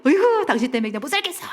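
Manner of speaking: quick, high, exasperated scorn; mock contempt. Spouse quarrelling before worship, quick contemptuous exasperation. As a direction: imitate a fed-up spouse's put-down. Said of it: "그래서 또 경멸하고".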